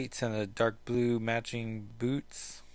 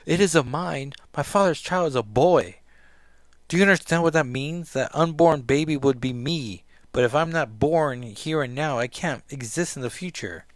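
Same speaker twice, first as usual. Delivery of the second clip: loud, desperate pleading; upset outburst; 4 semitones higher, 8 dB louder than usual. Acted. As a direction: raise it in despair.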